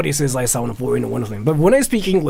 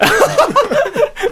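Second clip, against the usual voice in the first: high laugh; 4 dB louder than usual. Real, not acted. Reaction to attempt.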